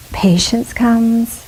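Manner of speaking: normal talking voice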